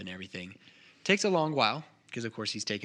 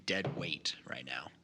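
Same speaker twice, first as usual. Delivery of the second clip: whisper; 7 semitones lower, 8 dB quieter, slower than usual. Real, not acted. GM muttering the dead-weight joke.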